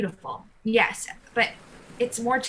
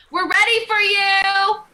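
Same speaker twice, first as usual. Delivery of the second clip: calling out; 10 semitones higher, 7 dB louder, slower than usual. Acted. Calling offstage to Anxiety.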